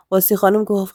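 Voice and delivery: ordinary delivery, nothing marked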